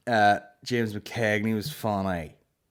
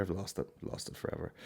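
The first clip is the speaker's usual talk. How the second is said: under-breath aside; 12 dB quieter than usual. Real, not acted. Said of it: "muttering to himself".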